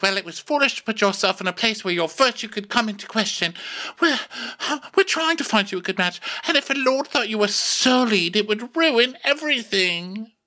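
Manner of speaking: high-pitched mock royal lecture; hammed-up high scolding; scolding queen. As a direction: lecture in a high, fretful put-on voice. Voices Alicent scolding the princess.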